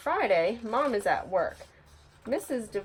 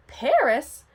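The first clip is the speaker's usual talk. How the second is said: excited surprise; 6 semitones higher, 7 dB louder than usual. Acted. Nancy excited at news.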